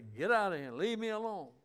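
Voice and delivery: mock brush-off. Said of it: "they say".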